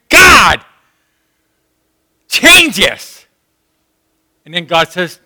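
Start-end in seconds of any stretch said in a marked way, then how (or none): 0.1-3.3 loud, higher shout to God; venting shout. Retold or imitated. Believer venting at God, a loud shout. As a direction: yell it at heaven.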